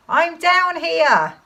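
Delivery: high, loud, drawn-out call; slow holler from down in a pit. Acted. Father calls up from the pit.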